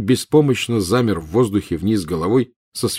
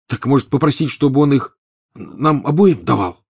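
eager exclaim; 6 semitones higher, 3 dB louder than usual. Acted. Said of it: "с жаром воскликнул Рон".

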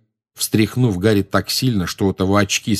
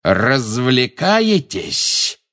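very slow, menacing question; drawn-out threat, pitch raised; contained fury; 4 semitones higher, much slower than usual. A narrator's reading. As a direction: draw the word right out in anger. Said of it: "с побелевшим от ярости лицом".

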